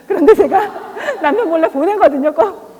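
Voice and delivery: giggling through a confession; laughing. Real, not acted. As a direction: laugh while owning up to the secret. Laughing while confessing secret money. (웃으면서 고백하듯)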